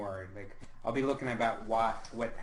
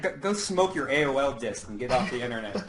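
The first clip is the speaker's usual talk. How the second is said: laughing; 4 semitones higher, 7 dB louder than usual. Real, not acted. Mocking the question, laughing.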